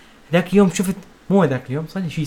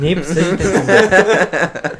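slow words through laughter; laughing while talking; 3 semitones higher, 5 dB louder, much slower than usual. Real, not acted. Laughing mockery.

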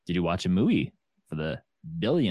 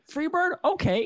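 surprised question. Retold or imitated. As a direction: imitate the performer's high disbelief. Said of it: "he went".

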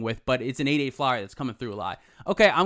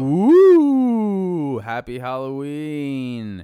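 spooky Halloween voice; 8 dB louder, much slower than usual. Pretend. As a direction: ham up a ghostly greeting. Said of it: "So we could say, like".

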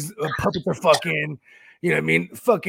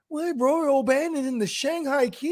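mock disbelief; 9 semitones higher than usual. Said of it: "He's like".

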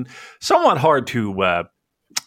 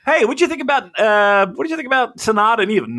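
high, fast mock host question; mocking ourselves as eager hosts; 10 semitones higher, 3 dB louder, faster than usual. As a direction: ham up a keen interviewer. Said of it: "everything we go".

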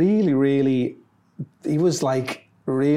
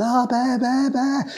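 high-pitched fan squeal calling his name; mock screaming fans; 11 semitones higher, slower than usual. Crazed fans calling out to Bear.

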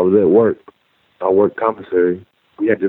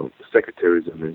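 ordinary delivery

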